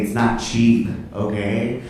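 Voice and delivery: normal talking voice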